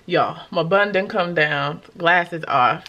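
ordinary talk, nothing marked

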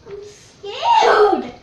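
rising from hushed to loud cry of fear; scared. Pretend. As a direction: start small, then burst out scared.

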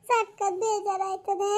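slow, drawn-out wail; crying lament. Acted. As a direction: stretch each word out through tears. Daughter crying over collapsed mother.

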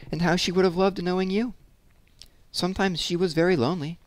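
put-on high-pitched old lady with a faint foreign accent; lightly voiced foreign old lady looking back. A narrator's reading. Old lady speaks of her lost girlhood, faint foreign accent. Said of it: "with its faint foreign accent".

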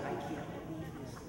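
very soft, low inner thought; hushed, quick aside to yourself; quiet disbelief. Retold or imitated. His shaky thought at mile three.

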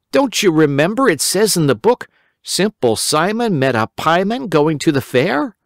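put-on high, eager boy voice; a worried little boy pleading; Simple Simon. A narrator's reading. Simple Simon explaining to the rabbit, high boyish voice.

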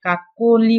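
slow কাকলি with a drawn-out o in the middle; middle vowel as o. Shown as a demonstration. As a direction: stretch the middle of কাকলি into a clear "ko". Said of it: "অনেকটা ও এর মতো হয়েছে". (ধীরে, মাঝের অ-কে ও করে "কাকলি")

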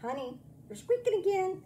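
exasperated hesitation. Retold or imitated. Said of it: "A few minutes later".

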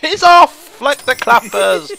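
loud, high, laughing commentary; slow, loud, giggly excitement; gleeful laughing. Real, not acted. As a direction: call it out through laughter. Delighted at trampoline bouncing.

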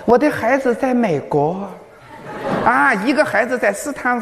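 lightly voiced proud old woman; proud old lady. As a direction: imitate a grandmother bragging about her kids. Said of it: "老人家那个骄傲的".

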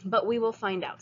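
ordinary delivery, nothing marked

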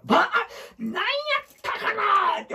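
usual speaking voice

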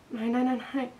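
high cry that loses its force; fading protest. A narrator's reading. Girl still protesting as she is shaken awake, voice fading. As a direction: repeat the refusal, weakening towards the end. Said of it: "stemmen blev lavere og lavere".

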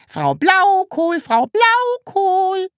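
very high, slow, piping little voice; squeaky peep. A narrator's reading. Sams peeps up from under the table, very high. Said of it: "piepste währenddessen das Sams".

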